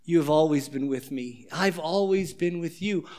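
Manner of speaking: put-on father gently soothing the older son; tender father. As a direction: hold the hurt son close with words. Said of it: "He says".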